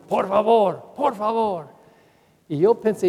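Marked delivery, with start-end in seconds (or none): 0.1-1.7 mock shouting nun. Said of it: "ella siempre estaba gritando".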